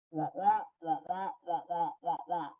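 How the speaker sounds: muffled, soft wah-wah babble; quiet cartoon-style trombone-like mumbling; Charlie Brown's teacher. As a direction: imitate droning, meaningless grown-up talk. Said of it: "like Charlie Brown's teacher".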